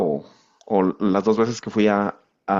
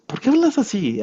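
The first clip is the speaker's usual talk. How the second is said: puzzled question; 11 semitones higher than usual. Retold or imitated. Coworker puzzled by his accent.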